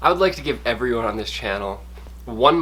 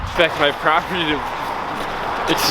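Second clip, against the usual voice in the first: cold stiff lips, words slurred; frozen lips; 4 semitones higher than usual. Real, not acted. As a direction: speak through lips numb with cold. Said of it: "my lips are frozen".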